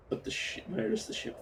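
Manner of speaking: near-whispered, uneasy; quietly alarmed; hushed worry. Real, not acted. Ship missing, worried.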